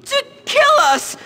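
high frightened yelp; 겁에 질린 날카로운 외침; panicked cry. Acted. Panicked nerd, jocks coming.

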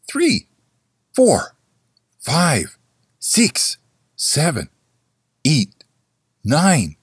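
high, ringing count of the strokes; bell counting. A narrator's reading. The big bell striking the hours, high and ringing. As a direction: chime out each number like a bell. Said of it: "said the bell".

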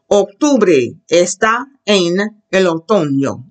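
slow teacher model, word by word; slow modelling. Shown as a demonstration. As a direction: model the Spanish phrase slowly, in separate pieces. Models a phrase for class.

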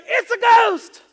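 very high, very loud acted cry; panicked shout. Disciples in freak-out mode spot Jesus, panicked shout. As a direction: yell it as the freaked-out crew. Acts disciples crying out in storm.

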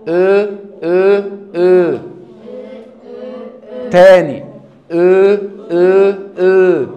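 "او" very loud, slow and stretched; loud slow o drill. Shown as a demonstration. Teacher drills "او" loudly for the class. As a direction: belt a long round "او". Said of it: "اقعد شغل".

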